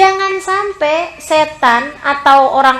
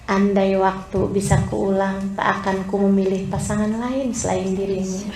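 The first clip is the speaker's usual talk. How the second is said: tender devotion; 7 semitones lower, 8 dB quieter than usual. Acted. Wife gazing at her husband, voicing tender devotion. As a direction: murmur it adoringly, drawing out the words. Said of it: "Saat kamu pandangi suamimu".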